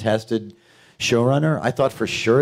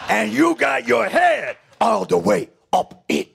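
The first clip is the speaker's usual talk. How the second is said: much higher, exploding shout on ass; Pacino-style scream; 12 semitones higher than usual. Retold or imitated. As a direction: blow up at the end of the line. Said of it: "it came out".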